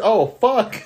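high, laughing fed-up outburst; exasperated retort. Retold or imitated. Guard retelling his answer to the girl, exasperated outburst. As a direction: blurt it out, fed up. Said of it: "So I said".

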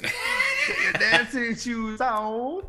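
loud, high-pitched crowd-pumping shout; hype man. Acted. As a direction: pump up the crowd like a gig MC. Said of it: "I should be a hype man".

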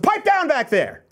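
slow, high, mock-stern bark at the back seat; hushing the inner voice like a cranky dad in a movie; Phil Hartman as Turbo Man. Telling the doubting voice in your head to shut up, in a lightly voiced movie-dad impression. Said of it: "Like Phil Hartman in Turbo Man".

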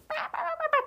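put-on very high babbling baby tantrum. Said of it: "throw a temper tantrum and be like".

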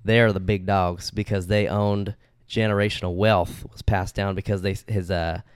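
slow, put-on Southern storytelling; playful Southern US accent. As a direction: stretch the words in a Southern drawl. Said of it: "tell the story in an accent".

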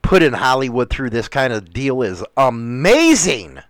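ordinary talk

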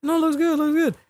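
very high, airy brush-off mimicry; mock the doctor in a high voice; mock smug reassurance. Doctor brushing off the patient's question, mock smug. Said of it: "how smug these fucking doctors are".

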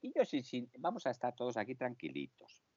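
deep voice. Acted. Low muffled voice change.

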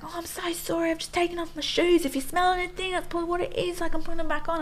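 high, overdone broad Australian accent, imitating the husband; mock her husband's broad Australian apology. Wife imitating husband apologising to strangers, broad Australian accent. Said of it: "in, in his most broad Australian accent".

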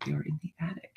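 spooky whisper. Acted. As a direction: hush it like a secret. Spooky reveal of attic.

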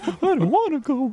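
high whiny mock sobbing; whiny crying. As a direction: whine it like a crying kid. Co-host joins the mock whine.

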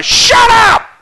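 very high, loud scream; Bill Clem. Acted. Umpire to everybody at the game, screaming. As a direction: scream it out at full force. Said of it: "He just screams at everybody".

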